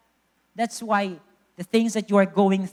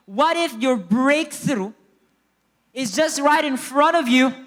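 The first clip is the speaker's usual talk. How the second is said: raised, emphatic preacher's delivery; full-force preaching; 5 semitones higher, 7 dB louder than usual. Real, not acted. Preacher asks congregation about their breakthrough, full-force preaching. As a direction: drive each phrase out to the congregation.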